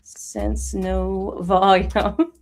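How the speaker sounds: very slow, low typing voice; low, drawn-out chat dictation; chat speak. Real, not acted. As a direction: stretch each word as if typing it. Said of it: "chat speak".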